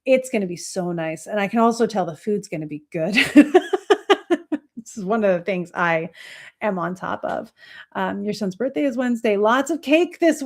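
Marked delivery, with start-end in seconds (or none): 3.2-4.7 laugh. Real, not acted. Laughing after food remark.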